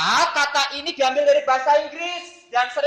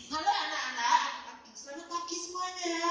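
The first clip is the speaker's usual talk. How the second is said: funny teacher impression; 5 semitones higher, 10 dB quieter than usual. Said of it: "Ada guru saya lucu banget".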